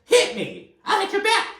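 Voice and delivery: hammed-up rant in a very high, strained pitch; pissed-off Todd, exaggerated. Imitating a pissed-off husband for listeners, over-the-top angry. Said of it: "That's, that's how he talks".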